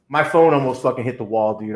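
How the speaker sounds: ordinary delivery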